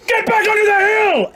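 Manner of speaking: shout. Acted. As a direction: yell it as you shove him back. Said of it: "She says".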